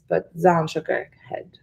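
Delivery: ordinary delivery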